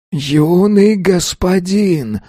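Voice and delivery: high, slow begging cry; desperate plea. A narrator's reading. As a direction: beg him not to kill. Ghost keeps pleading.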